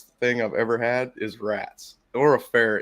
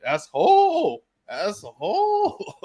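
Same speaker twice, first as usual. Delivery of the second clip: high, then slowly drawn-out asshole; lightly voiced dog repeating asshole; imitating the dog; 8 semitones higher, 4 dB louder, much slower than usual. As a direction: do the dog saying asshole, stretching the second. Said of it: "That's all he said".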